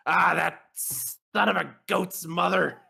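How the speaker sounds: high, drawn-out roar of outrage; roaring outburst. Acted. Smith hearing of the king, roaring. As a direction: roar it out, pitch jumping up. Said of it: "Baron just roars".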